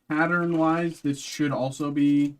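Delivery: ordinary talk, nothing marked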